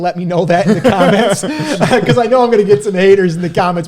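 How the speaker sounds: laughing through the words; chuckling while teasing the fans. Real, not acted.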